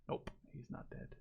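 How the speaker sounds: hushed whisper. Real, not acted. Commentator whispering during a tense moment in the game. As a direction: breathe it so nobody hears.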